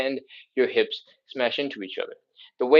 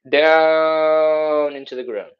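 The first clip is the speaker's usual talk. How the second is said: slow coaching cue; 2 semitones higher, 7 dB louder, much slower than usual. Shown as a demonstration. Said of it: "bring this belly button".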